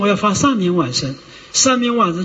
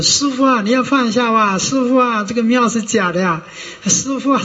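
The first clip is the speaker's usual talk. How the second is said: higher, whiny pleading voice of a devotee; mock pleading devotee; 4 semitones higher than usual. Devotee pleading with the dying master, higher and whiny. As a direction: wheedle the sick master like a pushy follower.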